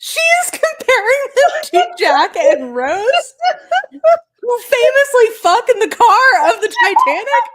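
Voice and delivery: high, loud laughing disbelief; laughing shout. Real, not acted.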